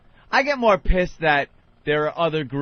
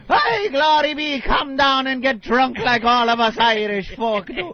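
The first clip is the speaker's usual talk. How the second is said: put-on Irish accent; 7 semitones higher than usual. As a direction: boom it like a stage Irishman. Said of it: "a bar with an Irish guy".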